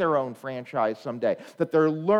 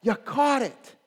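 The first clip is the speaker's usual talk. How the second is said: put-on loud, higher cheer for the kid; 7 semitones higher, 5 dB louder, much slower than usual. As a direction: celebrate like a proud parent. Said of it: "you go".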